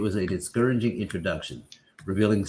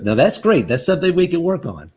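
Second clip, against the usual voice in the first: higher, louder chirpy counsellor voice; mock cheery listener; 8 semitones higher, 6 dB louder than usual. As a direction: play the overly upbeat helper. Voicing the upbeat listener.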